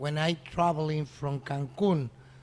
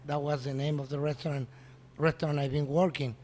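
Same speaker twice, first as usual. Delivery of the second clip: ordinary delivery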